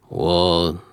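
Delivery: low, even voice of a dry, practical father; a hint of a stern dad laying down the rule. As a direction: hand down a flat verdict as a distant dad. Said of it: "like, very stern".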